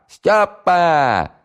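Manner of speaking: "Стёпа!" yelled high and loud, stretched long on the "п"; drawn-out full-voice yell. Acted. Valiulina calling Styopa again, a longer stretched yell. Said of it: "заорала во всё горло".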